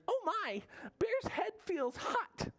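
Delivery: very high, tiny animal voice; little Mouse voice. Acted. Mouse checks on sick Bear, very high little voice. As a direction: squeak it like a worried little mouse. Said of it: "Mouse mutters".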